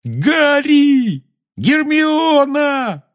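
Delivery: ecstatic high, loud yell. A narrator's reading. Ron waving the cup at his friends, yelling. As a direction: holler the names, stretched out high. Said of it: "завопил Рон".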